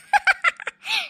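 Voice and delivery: high-pitched wail; acted crying fit. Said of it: "இதுக்கு இப்படி அழுவுற".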